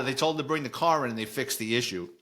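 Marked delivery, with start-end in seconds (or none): none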